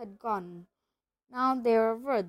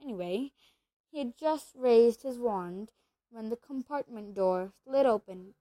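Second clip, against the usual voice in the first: plain reading voice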